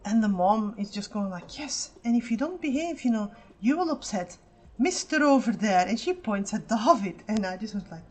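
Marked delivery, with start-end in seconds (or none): 1.6-5.9 put-on higher and louder, a mother warning her child in a syrupy tone; mock sweet mom threat. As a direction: do the mom scaring her kid with a stranger. Mom threatening misbehaving toddler.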